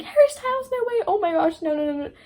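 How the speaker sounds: high, loud squeal that fades into repeated noes; excited shriek. Real, not acted. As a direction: fangirl screaming at the name, then winding down. Shrieking at a lyric reference.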